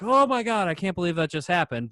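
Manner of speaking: retold fan disbelief, starting high then easing down; starstruck exclamation. Fan once the star has left, starstruck disbelief.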